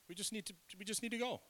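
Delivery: high, quick squeak; squeaky self-correction. Real, not acted. Preacher fixing his slip to the congregation, high squeaky rush. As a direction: blurt the correction fast, way up high.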